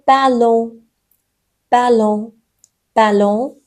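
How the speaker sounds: بلون with a nasalised last vowel; nasal N. Shown as a demonstration. French teacher repeating an example for learners, بلون with nasal ending.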